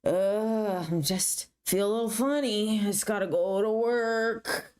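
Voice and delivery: mock groaning mother, feeling funny; mom's woozy voice. Mom excusing herself to her teen, woozy. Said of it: "my mom's like".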